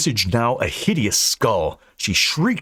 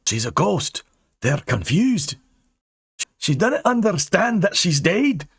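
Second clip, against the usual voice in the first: put-on high, urgent old Scot; old Scot Angus; 11 semitones higher than usual. Angus explains the ghost to Dane. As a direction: warn the lad, Scottish lilt.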